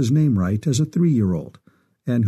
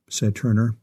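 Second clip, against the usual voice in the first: plain reading voice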